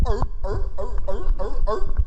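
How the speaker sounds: high, repeated gulping yelps; herring-gobbling noises. Acted. Comedian eating herring head back by the tail, gobbling noises. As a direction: imitate a seal gulping down fish. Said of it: "and you go".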